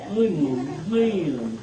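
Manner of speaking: slow, playful lamb puppet voice; Lamb Chop voice. Shown as a demonstration. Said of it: "this is lamb chop".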